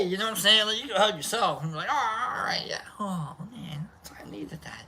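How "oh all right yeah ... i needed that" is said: acted contented murmur, fading to whispered breath; hushed relief. Hugging yourself and needing it, hushed mock relief. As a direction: trail down into a whisper. Acting out relief at self-hug.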